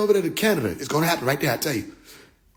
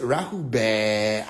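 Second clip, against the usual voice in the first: low, very slow intonation; mock greeting; 10 semitones lower, 4 dB quieter, much slower than usual. Woman greeting the aliens with Rahu bad, low slow drawl. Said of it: "she said".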